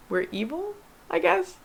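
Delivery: mock-confess with a questioning lift; sarcastic mock confession.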